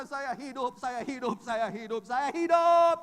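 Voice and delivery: loud repeated yell, high; frantic shouting. Retold or imitated. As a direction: chant it at full voice. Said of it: "teriak-teriak seperti orang gila". (berteriak-teriak seperti orang gila)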